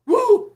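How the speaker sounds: high, loud whoop of hype; shouted woo like a player celebrating a sack; fired-up woo. Retold or imitated. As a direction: let out a pumped-up whoop.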